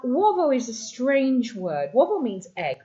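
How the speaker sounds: normal talking voice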